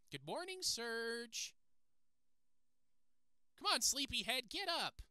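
high, singsong put-on parent voice; parent's wake-up call. Parent waking Surge in the morning, high-pitched chivvying.

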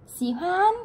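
xihuan said with the Mandarin tones; rising on Xì hoan; Chinese word said aloud. Shown as a demonstration. Teacher models Chinese for Vietnamese students, clear tones. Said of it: "lấy chữ khan này".

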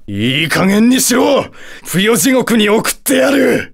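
high, theatrical angry outburst; exasperated yell. Acted. Satan losing patience with a challenger, exasperated yell. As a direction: lose patience and cry out the threat.

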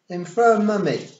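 put-on high, loud toddler voice; piping child finishing the sentence; little boy. A narrator's reading. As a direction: recite the rule like a small child. Small boy recites a rule.

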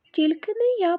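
high, loud mouse squeak. Acted. Second mouse refusing the rooster, loud high squeak. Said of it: "пропищав Верть".

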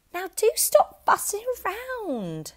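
tired scolding; wife's voice. A narrator's reading. Wife to husband tossing in bed, exasperated. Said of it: "his wife said to him".

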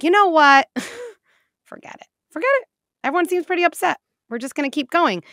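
high, loud giddy pilot giving in; caving pilot. Pretend. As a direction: cave in brightly like a spineless captain.

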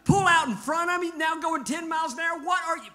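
high, loud road rage shout; yelling at driver. Acted.

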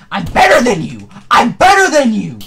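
real gloating shout; boastful shout. Real, not acted. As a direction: crow it in triumph. Boasting loudly.

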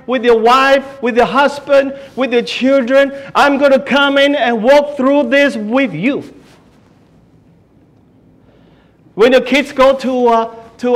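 fervent pulpit delivery; full-force preaching. Acted. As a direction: preach it out to the whole congregation.

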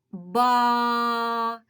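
Ба with the А held long and level; Бааа, sustained on one note; drawn-out А. Shown as a demonstration. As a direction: stretch the vowel in Ба. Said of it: "Чуємо звук А".

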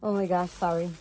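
normal talking voice

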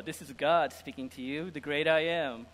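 ordinary delivery

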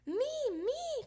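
high, excited little pipe-up; eager cry. A narrator's reading. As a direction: beg to be picked, high-pitched. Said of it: "cried Polly Woggylina".

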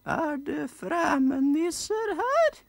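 put-on very high, slow piping old voice; piping old nisse. Old nisse in the corner asking, slow high piping. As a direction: squeak it out slowly like an ancient elf. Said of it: "peip den gamle nissen".

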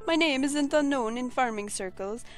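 hammed-up Italian accent. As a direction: vain Italian farmer, dramatic. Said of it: "gave him an Italian voice".

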